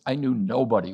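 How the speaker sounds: ordinary delivery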